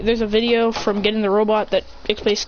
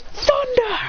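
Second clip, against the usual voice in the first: high-pitched battle cry; acted anime attack cry; attack call; 13 semitones higher than usual. Hero calls out his attack.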